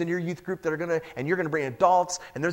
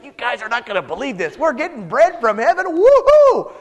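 higher and louder, rising to a whooping cheer; excited shout; 5 semitones higher, 8 dB louder than usual. Acted. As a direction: burst out with the best news ever. Moses bursting with good news.

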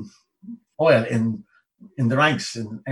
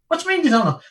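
high disbelieving demand; can't believe what he's hearing; incredulous; 3 dB louder, much faster than usual. Retold or imitated. Sergeant to the private driver, high and incredulous.

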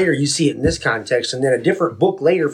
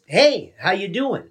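slow, drawn-out "Hey", pitched up; slow lilting greeting; his own intended reading; 3 semitones higher, slower than usual. Shown as a demonstration. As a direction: stretch "Hey" high, then ease into the question. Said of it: "the way that I say it is".

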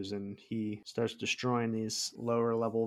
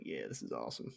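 much lower, quiet gloating growl; deep mock hero voice; 12 semitones lower, 14 dB quieter, faster than usual. Friend imitating Baltimore enjoying the fight in a deep mock voice. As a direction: put on a low, pleased hero rumble. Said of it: "he's like".

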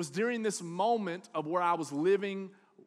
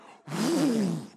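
loud blown whoosh; whooshing truck; 4 semitones higher, 6 dB louder than usual. Retold or imitated. Dad gunned it, imitated whoosh. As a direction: blow a rush of air like a vehicle tearing off.

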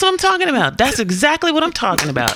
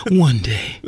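much lower, put-on old film character; Sounder voice; 9 semitones lower than usual. Redoing the one day speech on request, low Sounder voice. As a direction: drop low like the man in Sounder. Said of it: "in the Sounder voice".

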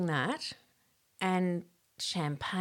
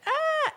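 a sudden high startled cry; startled yelp; 4 dB louder than usual. Retold or imitated. Naked boys recoil with a high startled yelp. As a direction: yelp in shock as she turns round. Boys react seeing her face.

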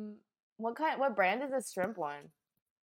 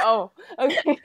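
laughing; 12 semitones higher, 6 dB louder, slower than usual. Retold or imitated. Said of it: "I was like".

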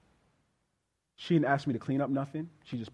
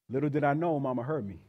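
ordinary talk

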